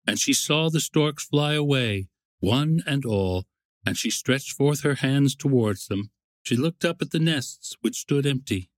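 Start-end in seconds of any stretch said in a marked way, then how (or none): none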